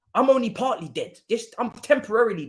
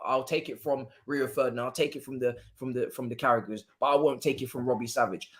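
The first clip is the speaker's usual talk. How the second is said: quoted lower and softer, a hint of John Terry; quoting John Terry; 8 semitones lower, 5 dB quieter than usual. Retelling what John Terry said to a football fan, quieter. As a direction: lightly mimic John Terry, hushed. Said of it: "what John Terry said about".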